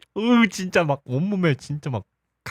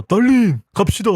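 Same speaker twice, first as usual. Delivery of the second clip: raised call from across the room, a bit louder; calling her away; 6 semitones higher, 3 dB louder than usual. Retold or imitated. Jealous man calling his date away from a rival, raised call. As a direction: call her name from afar to pull her away. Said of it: "상철이가".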